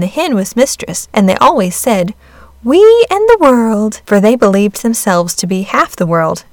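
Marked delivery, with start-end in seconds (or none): 2.6-4.0 high, loud, self-important; puffed up with pride; proud boast. Acted. Hen and cat boasting proudly.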